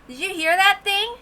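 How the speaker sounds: ordinary delivery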